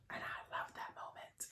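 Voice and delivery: breathy hushed whisper. Real, not acted. Whispering to viewers about the moment she loved. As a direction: whisper it like a secret aside. Whispered aside after praising Halle.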